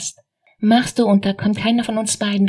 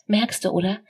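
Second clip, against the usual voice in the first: normal talking voice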